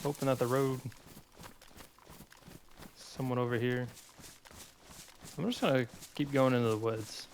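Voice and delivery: ordinary talk, nothing marked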